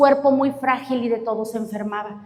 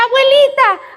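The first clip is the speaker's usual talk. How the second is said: put-on high, bright shout of a little girl; excited child calling; 10 semitones higher, 4 dB louder than usual. Little girl runs to greet her grandmother, calling excitedly. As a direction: call out happily to grandma.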